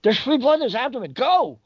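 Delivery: high, fast urgent yell; yell in her face. Retold or imitated. Yelling at the doctor to rush to her patient. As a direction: bark it at her to get her moving. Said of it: "And I yell in her face".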